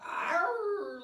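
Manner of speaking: loud, high pirate "Arr"; pirate growl. Shown as a demonstration. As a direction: growl it out like a big old pirate. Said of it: "like you're a big old pirate saying a word".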